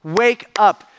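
punchy, separated words; emphatic wake-up call. Retold or imitated. Jesus clapping in the disciples' faces, emphatic wake-up call. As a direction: jolt them awake word by word. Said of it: "he's clapping in their faces".

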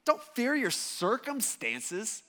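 slow, measured correction, dropping at the end; Jesus setting them straight, slowly; acted rebuke.

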